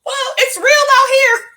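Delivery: high, loud startled yelps; a man suddenly seeing the storm round him; shocked whoa, pitch flying up; panicked surprise. Retold or imitated. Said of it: "he was like".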